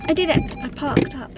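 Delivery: high, happy cheer of relief; excited triumph. Real, not acted. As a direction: cheer it like you just won. Triumphant after parking.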